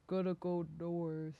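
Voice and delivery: slow, plodding mutter to himself; muttered, drawn-out character voice; muttering Sylvester. Acted. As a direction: mumble it slowly like a lumbering figure. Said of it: "hear him muttering".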